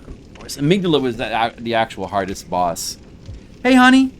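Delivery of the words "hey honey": excited greeting. Real, not acted. Greeting girlfriend on call.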